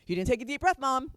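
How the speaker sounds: lightly voiced child calling mom out; child scolding mom. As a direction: play a small child catching mom out. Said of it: "Little kids will remind you".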